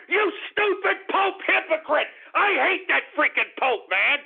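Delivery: high, angry sneer. Real, not acted. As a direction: spit out the insult. Ranting insult at the Pope.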